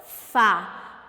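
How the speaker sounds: "Fá" alone, loud and low; isolated tonic "Fá", said with force; stressed syllable. Shown as a demonstration. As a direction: land firmly on "Fá". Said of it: "Qual é a sílaba tônica?".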